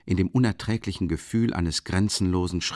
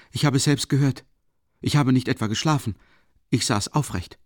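usual speaking voice

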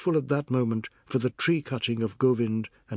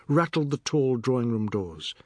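ordinary delivery, nothing marked

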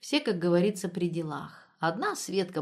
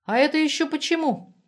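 high, louder defiant question; defiant challenge; 8 semitones higher, 6 dB louder than usual. A narrator's reading. Светка challenging Наташка's order to move out, defiantly. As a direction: challenge them, pitch jumping up.

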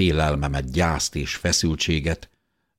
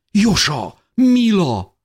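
much higher and louder joyful call; 7 dB louder, slower than usual. Acted. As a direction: call their names across the crowd. Grandmother calling out joyfully. (örömteli, hangos kiáltás)

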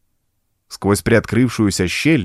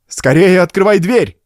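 urgent shout, higher and louder; yelling across the room; 8 semitones higher, 5 dB louder than usual. Acted. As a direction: shout it to hurry him. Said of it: "воскликнул Энтарт".

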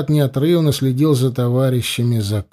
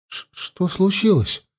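higher, stammered frightened question; trembling voice; 7 semitones higher than usual. A narrator's reading. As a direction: stammer the first word, pitched up and uneasy. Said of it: "послышался рядом дрожащий голос Метты". (дрожащим голосом, с запинкой)

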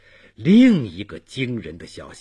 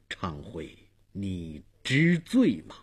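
deeply grieved; 5 semitones lower, 5 dB quieter than usual. A narrator's reading. Dong Wang turns to question Bei Wang, deeply grieved. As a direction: soften and draw it out with heavy sorrow. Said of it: "又无限沉痛地对北王说".

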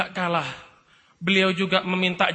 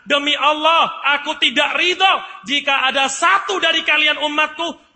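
higher and louder, fierce vow; impassioned shout; 6 semitones higher, 4 dB louder than usual. Acted. The Prophet vows to God for his people, loud impassioned cry. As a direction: swear it out loud for your people.

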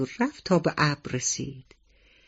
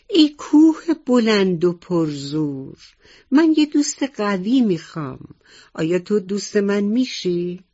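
higher, louder call up the mountain; calling loudly; 4 semitones higher, 5 dB louder than usual. Acted. Little mouse calls up loudly to the tall mountain. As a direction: call out to the mountain far above. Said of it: "با صدای بلند سلام کرد و گفت".

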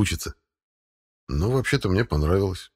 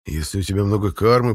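ordinary delivery

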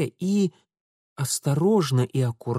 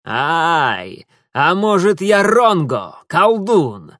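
louder Roma voice with forced grin; crooked grin; 5 semitones higher, 8 dB louder, much slower than usual. A narrator's reading. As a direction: squeeze out a wry joke. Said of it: "выдавил кривую улыбку цыган".

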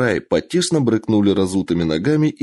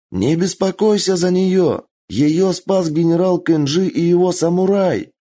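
raised reassuring voice; 9 semitones higher than usual. Acted. Бенджиро reassures loudly.

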